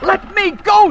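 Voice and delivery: high, loud terrified scream. Acted. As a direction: scream to be let out. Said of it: "she screamed".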